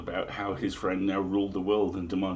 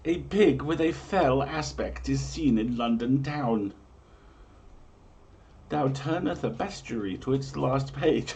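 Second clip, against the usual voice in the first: grand, raised olde-English storyteller read; dramatic prophecy reading; 4 semitones higher than usual. Acted. Host reading medieval omens to listeners, grand storyteller voice. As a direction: proclaim each omen like a town crier.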